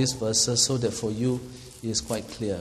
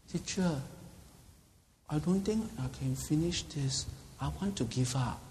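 put-on girl giving up; 9 dB quieter than usual. Voices a new girl.

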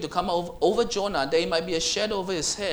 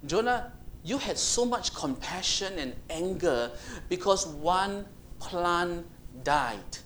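slow reasoning; slower than usual. Acted. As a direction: stretch out one plant died. God reasoning with Jonah.

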